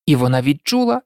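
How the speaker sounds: usual speaking voice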